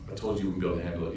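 soft, fast told-you-so; a quiet, rapid warning; low, quick reproach. Acted. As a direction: say it low and hurried. Khidr's calm warning.

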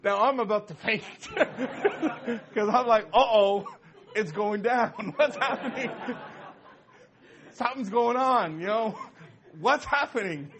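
laughing panic. Real, not acted. Storyteller reliving the panic for the audience, laughing loudly. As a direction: tell it breathless with laughing fright. Retelling the exorcism moment, laughing.

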